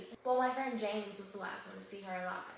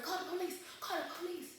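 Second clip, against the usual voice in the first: higher, urgent repeated call for help; urgent plea; 8 semitones higher than usual. Retold or imitated. Man at the crime scene begging someone to call the police, higher and urgent. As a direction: push it out like a panicked bystander.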